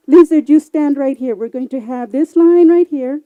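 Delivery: loud, firm setup of the race; loud directions. Acted. Moderator loudly placing lizard at the starting line. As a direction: take charge of the line.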